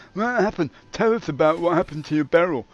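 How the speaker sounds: high, loud nosy interviewer; pushy interviewer voice. Pretend. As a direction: pester him in a put-on voice. Mock interviewer voice cuts in.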